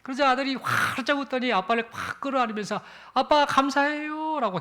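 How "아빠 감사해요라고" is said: put-on high, bright burst of a child's thanks; higher, delighted child's voice; joyful thanks. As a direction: hug him as you thank him. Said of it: "기뻐하고는".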